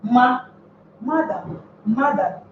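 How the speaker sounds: madam said with weight on the first syllable. Shown as a demonstration. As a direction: stress the "ma" of madam, first alone, then in the word. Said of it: "a higher voice pitch".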